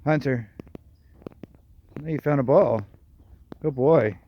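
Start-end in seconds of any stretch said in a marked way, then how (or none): 2.0-4.2 happy praise. Real, not acted. Praising the dog.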